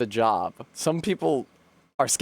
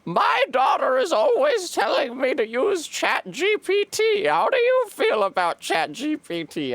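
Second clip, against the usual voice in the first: put-on old woman voice; 14 semitones higher than usual. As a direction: squawk like a chatty old woman. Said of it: "She said".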